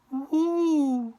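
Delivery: long high-pitched "woo"; high whoop. Acted. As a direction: let out a high, drawn-out whoop.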